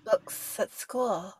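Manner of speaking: slow, soft "books at school", tongue just behind the teeth; imitated speech quirk. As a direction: draw out "books at school" in her not-quite-lisp. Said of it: "just behind the teeth".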